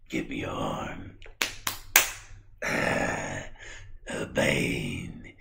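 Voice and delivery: very slow, low, soft creepy voice; sinister drawn-out doctor drone; Victor. Acted. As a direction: stretch every word out eerily. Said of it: "why do you talk like that".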